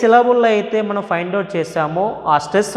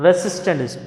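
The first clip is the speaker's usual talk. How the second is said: ordinary talk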